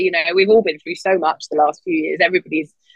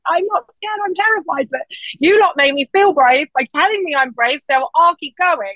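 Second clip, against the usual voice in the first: higher, wide-eyed mock terror; higher voice admitting terror, slowing at the end; terrified but brave; 8 semitones higher than usual. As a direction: play up the fear, then steel yourself. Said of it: "I'm like".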